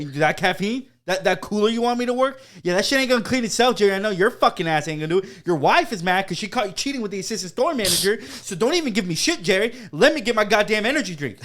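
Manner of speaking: loud, high comic tirade; exasperated mock outburst; hammed-up angry rant. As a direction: tear into the boss, playing it up. Acted rant at boss Jerry.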